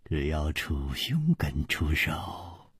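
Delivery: a soft, smiling hint that ends in a whisper; faint smile. A narrator's reading. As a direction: let it fade to a whisper. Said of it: "微微笑着说".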